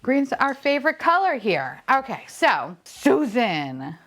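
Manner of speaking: ordinary talk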